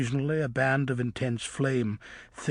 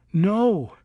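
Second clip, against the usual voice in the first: horrified; 8 semitones higher, 6 dB louder than usual. Acted. Luna horrified.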